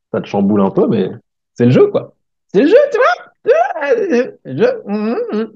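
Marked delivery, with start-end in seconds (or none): 1.5-5.5 very high, breaking mock cry; mock squeaky wail. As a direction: squeal it like a comic sob. (plainte comique, voix très aiguë qui se brise)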